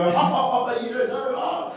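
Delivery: high mocking ha-ha taunt; mocking crowd. Friends teasing the clueless colleague, high mock jeer. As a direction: tease in a high sing-out voice. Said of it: "and we all go".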